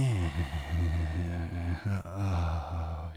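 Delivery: long slow sighing exhale. Real, not acted. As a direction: let the breath out slowly. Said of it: "I need to calm down".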